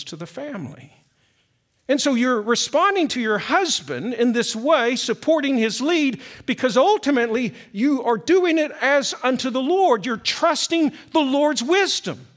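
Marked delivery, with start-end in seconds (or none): 1.9-12.3 louder, higher urging sermon voice; preaching. Acted. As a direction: press the point home to the wives. Pastor urging wives in sermon.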